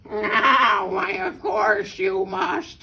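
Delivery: loud, slow, drawn-out agreement; theatrical fox voice; scheming fox. A narrator's reading. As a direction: stretch it out as an over-obliging fox. Sly fox agrees with birds.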